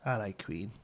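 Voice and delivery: put-on soft, broad Scouse accent; older Scouse. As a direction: drop it quietly in Liverpudlian. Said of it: "a lot of the older Scouse".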